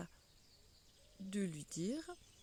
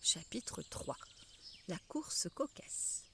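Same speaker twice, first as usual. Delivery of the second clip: chuchoté; 3 semitones lower, 7 dB quieter, slower than usual. Acted. Titre du chapitre chuchoté.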